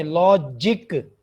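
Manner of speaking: "लॉजिक" split LO... JIK; correct pronunciation of logic. Shown as a demonstration. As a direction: separate both syllables of "लॉजिक", clear i. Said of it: "लॉजिक नहीं है".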